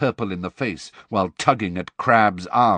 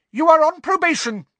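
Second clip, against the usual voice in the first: much higher, louder shrieked threat; 7 dB louder than usual. A narrator's reading. Umbridge threatens Snape with probation, shrieking. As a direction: shriek it at him. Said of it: "shrieked Professor Umbridge".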